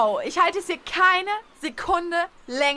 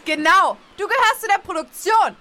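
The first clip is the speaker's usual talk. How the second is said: accusing; 3 semitones higher, 6 dB louder than usual. Acted. Joins the accusation.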